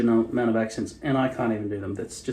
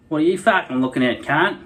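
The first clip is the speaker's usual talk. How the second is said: aggressive broad Aussie bogan, higher and louder; bogan accent; 3 semitones higher, 4 dB louder than usual. Retold or imitated. Bogan accent, to an imagined stranger. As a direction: pick a fight like a bogan at the pub. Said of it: "Like Bogan".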